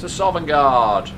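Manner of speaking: slow, loud heroic shout; epic battle cry. Acted. Player leaping into the portal, slow heroic bellow. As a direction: bellow it like a warrior charging.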